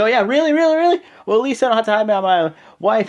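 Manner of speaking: loud, high, mocking comeback; indignant retort. Wrestler imitated taunting rival about his wife, indignant.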